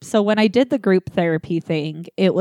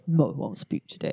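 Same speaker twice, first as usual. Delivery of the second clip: softer, lower mumbled refusal; quiet low decline; 5 semitones lower, 8 dB quieter than usual. Retold or imitated. Declining to speak at group therapy, quiet low. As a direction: shrink away from sharing.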